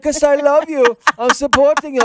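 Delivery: high British girlfriend imitation; mock British accent. Girlfriend telling Christopher Robin why she came, mock British accent. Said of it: "Oh, the British accent?".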